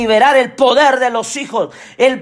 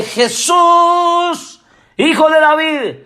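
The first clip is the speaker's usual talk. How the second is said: high, drawn-out cry to Jesus; acted plea pitched well up; imitated shout; 4 semitones higher, much slower than usual. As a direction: call out high, stretching the name. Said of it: "si aquel hombre Bartimeo no grita".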